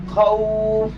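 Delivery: খাউফ drawn out, ending in f; pronunciation demo. Shown as a demonstration. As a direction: hold the glide of খাউফ before the final f. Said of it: "ফায়ের উচ্চারণ যেটা".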